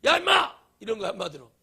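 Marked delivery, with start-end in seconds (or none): 0.0-0.5 God snapping at Moses, high and loud; angry yell. Retold or imitated. Preacher voicing an angry God yelling at Moses. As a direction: bark it like a furious God. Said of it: "막 열받은 거예요, 주님이".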